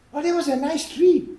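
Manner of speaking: high, loud chirp of delight; acted high-pitched enthusiasm; excited passenger. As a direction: play the carefree, distracting passenger. Passenger's silly answer.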